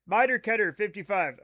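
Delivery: usual speaking voice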